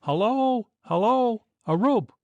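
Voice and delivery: high calling shouts; cried out. A narrator's reading. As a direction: hail the shore for help. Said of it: "cried Clough".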